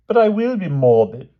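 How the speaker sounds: exclaimed. Acted. King protests to Grumor, loud and petulant. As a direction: insist like a sulky child. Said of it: "exclaimed the king".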